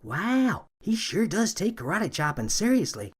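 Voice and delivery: hushed awe from the rookie; Becky whispering. Acted. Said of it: "Becky whispered to Henley".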